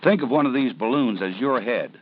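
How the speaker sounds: ordinary delivery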